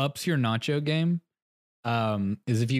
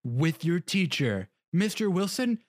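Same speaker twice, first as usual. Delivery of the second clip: shrill mock argument; 8 semitones higher, slower than usual. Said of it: "they're just sitting there arguing".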